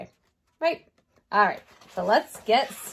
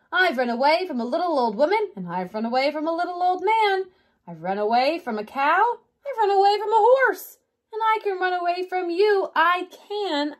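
mild storybook shout, climbing higher; 8 semitones higher, 3 dB louder than usual. Acted. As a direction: chant the boast out as it builds. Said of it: "and shouted".

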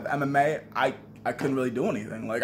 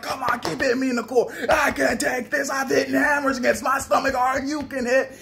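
mocking taunt; 9 semitones higher than usual. Goading the sparring partner, high mock taunt. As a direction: goad him to keep hitting. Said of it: "I'm like".

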